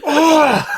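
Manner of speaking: high, loud mock wail of exasperation; hammed-up "oh" of despair; frustrated groan. Acts possessing thing's frustration.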